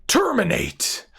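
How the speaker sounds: high, slow, drawn-out card-name cry; dramatic announcement. Acted. Card reviewer hypes the next card with a dramatic, slow, high call. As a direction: announce it like a showman.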